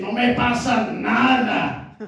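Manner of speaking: slow, sullen "nothing's wrong"; sulky with attitude. Retold or imitated. Wife answering her husband, sullen with attitude. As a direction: drag it out like a sulking wife with attitude. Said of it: "se lo dicen con actitud a uno".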